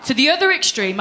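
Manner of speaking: usual speaking voice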